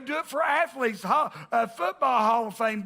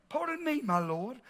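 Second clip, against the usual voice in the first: mock English accent; 5 dB quieter than usual. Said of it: "I hear it in an English accent".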